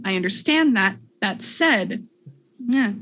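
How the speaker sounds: ordinary talk, nothing marked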